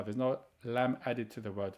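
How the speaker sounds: ordinary talk, nothing marked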